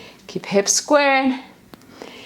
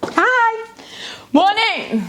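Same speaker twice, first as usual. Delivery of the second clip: much higher, bright neighbourly hi; high chirpy hello to the neighbours; mock sing-song hello; 10 semitones higher, much slower than usual. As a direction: greet the street in a put-on high voice. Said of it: "I'm like".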